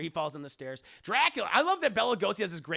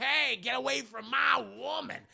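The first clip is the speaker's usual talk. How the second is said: higher, mock-threatening call; lightly voiced jealous Dracula; 6 semitones higher than usual. Dracula warning Jonathan off his woman, mock threat. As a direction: lightly play a possessive vampire.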